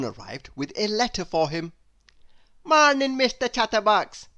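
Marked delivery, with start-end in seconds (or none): 2.6-4.3 high, loud, bright greeting; cheery postman. Acted. Postman greeting Mister Chatterbox at the door, high and loud. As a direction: call out a cheery hello from the doorstep. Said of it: "said the postman".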